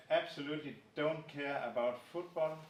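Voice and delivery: ordinary talk, nothing marked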